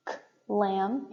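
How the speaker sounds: "k-lam" with the k broken off from lam; "k-lam", voiceless k set apart before the rest; split k sound. Shown as a demonstration. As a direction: sound out k, then lam, as two pieces. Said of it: "the k and the g".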